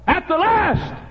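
high, loud preacher's cry; shouted refrain. Real, not acted. Preacher warning sinners of the end, sudden shout.

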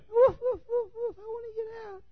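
very high little-dog voice begging; squeaky high dog voice pleading; talking Chihuahua. Acted. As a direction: whine to get out like a caged lapdog. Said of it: "what are you doing".

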